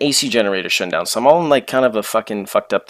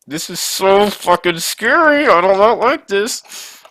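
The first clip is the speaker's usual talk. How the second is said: much higher and slow, like a frightened whine; scared; 10 semitones higher, 3 dB louder, much slower than usual. Retold or imitated. Telling a friend the flight is too scary, high slow fearful whine. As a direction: drag it out, scared stiff.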